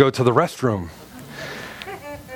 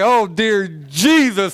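desperate plea; 3 semitones higher, much slower than usual. Acted. Desperate prayer on the floor.

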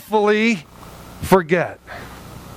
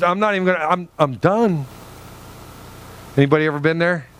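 ordinary delivery

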